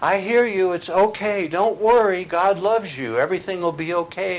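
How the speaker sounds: high, loud, reassuring call; calling comfort over the storm to his son; yelled back. Retold or imitated. Father yells back to scared son in storm, high loud reassurance. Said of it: "yelled back at him".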